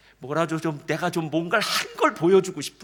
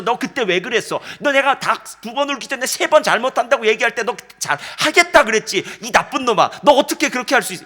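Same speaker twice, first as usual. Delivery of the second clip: loud, accusing rebuke, faster at the end; hammed-up reproach of a disciple, louder; mock scolding; 6 semitones higher, 10 dB louder than usual. Jesus scolding Peter in mock fury, loud and accusing. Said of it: "그거 일일이 따지면서".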